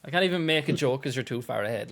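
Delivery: normal talking voice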